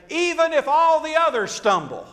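loud, high boast; acted-out bragging, ringing out loud; boastful Peter. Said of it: "He is boastful".

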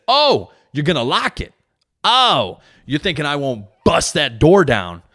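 high, loud sarcastic dad threat; mocking angry challenge. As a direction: taunt the son through the door. Dad challenging son's locked door.

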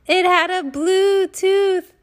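BLUE TOOTH drawn out slow, high and loud; hammed-up punchline. Monkey puppet telling dentist joke, hammed-up punchline. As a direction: land "blue tooth" big, high and stretched.

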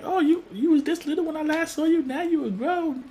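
high-pitched auntie voice; doting aunt. Retold or imitated. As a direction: coo over a visiting nephew in a high voice. Aunt marvels at grown nephew.

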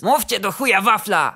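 loud, impatient order; angry demand. A narrator's reading. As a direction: swear and demand an answer loudly. Boss demands an answer. (głośne, zniecierpliwione żądanie)

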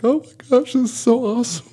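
high, teary overwhelmed cry; crying like a baby. Retold or imitated. Recalling Psalms, crying with overwhelmed joy. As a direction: voice cracking high with joy through tears. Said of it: "I started crying like a baby".

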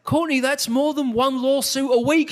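incredulous. Retold or imitated. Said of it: "And I said".

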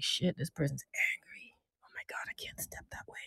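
panicked unvoiced whisper; startled whisper. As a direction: whisper it in sudden alarm. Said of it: "you now have to sit up straight like".

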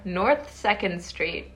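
slow, deliberate American accent address. Shown as a demonstration. Announcing where the haunted house stands, in an American accent. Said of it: "vous voulez l'accent américain".

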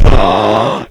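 sharp loud imitated gasp of shock; retold gasp. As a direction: mimic the manager's gasp at the wound. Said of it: "and he gasps".

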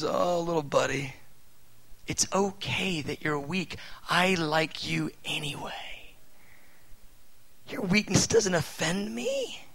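put-on loving father soothing his weak little boy, softly; tender fatherly voice. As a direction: comfort a small child, slowing down. God speaks tenderly to a child.

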